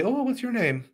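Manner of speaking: high-pitched, mock Irish lilt; bad Irish accent. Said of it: "Horrible accent".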